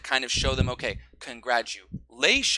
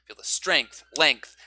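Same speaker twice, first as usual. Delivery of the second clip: normal talking voice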